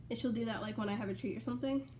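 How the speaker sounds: ordinary talk, nothing marked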